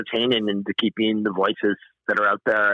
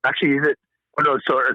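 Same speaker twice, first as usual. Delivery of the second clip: ordinary talk, nothing marked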